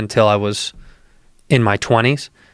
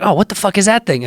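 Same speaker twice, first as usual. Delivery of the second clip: higher-pitched mock astonishment; startled reaction; 9 semitones higher than usual. Telling of first javelina sighting, startled. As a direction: replay being taken aback by a strange sight.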